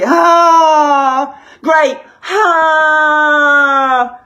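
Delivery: sarcastic wail; mock despair. Real, not acted. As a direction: groan it out in fake dismay. Mock despair, going on Facebook.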